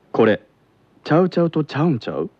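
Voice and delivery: Kansai dialect. Shown as a demonstration. Teacher demonstrating advanced syntax, quick Kansai dialect. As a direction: rattle it off with a Kansai lilt. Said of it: "これが関西弁では".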